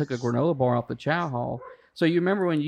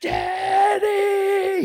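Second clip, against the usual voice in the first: much higher, louder drawn-out scream of Daddy; screaming out window; 5 dB louder than usual. Retold or imitated. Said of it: "screaming out the window".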